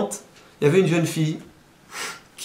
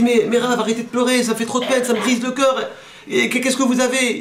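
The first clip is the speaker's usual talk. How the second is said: higher, pleading woman's voice; the daughter begging the weeping rabbi, higher and pleading; pleading young woman; 7 semitones higher than usual. Retold or imitated. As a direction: beg the rabbi to stop crying. Young woman begs crying rabbi.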